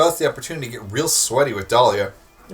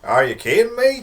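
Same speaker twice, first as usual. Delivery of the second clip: put-on southern accent; 8 semitones higher than usual. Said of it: "a southern accent".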